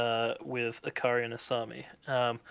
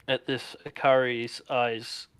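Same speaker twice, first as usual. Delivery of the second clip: ordinary talk, nothing marked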